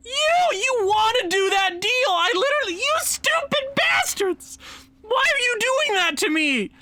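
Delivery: very high, screechy tirade; outraged outburst. Real, not acted. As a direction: shriek in comic outrage at being wronged. Outburst at the other team's refusal.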